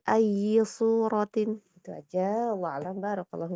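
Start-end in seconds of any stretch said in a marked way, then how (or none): 0.0-1.6 "ayyi" pressed at once, slow and loud. Shown as a demonstration. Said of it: "langsung ditekan". ("ayyi" langsung ditekan)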